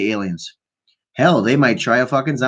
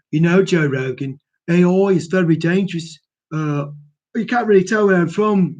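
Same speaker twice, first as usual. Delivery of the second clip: badly faked South African accent, higher pitch; Elon Musk; 6 semitones higher than usual. Podcaster mimicking a tech billionaire, bad South African accent. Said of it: "here's Elon Musk".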